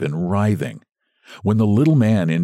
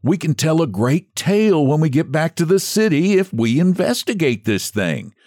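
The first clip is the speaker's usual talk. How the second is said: orating; 8 semitones higher than usual. Acted. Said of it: "making orations to his companions".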